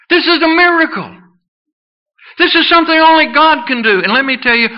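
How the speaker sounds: high, emphatic pulpit proclamation; soaring preacher's exclamation; impassioned preaching. Real, not acted. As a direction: insist on the wonder with full conviction.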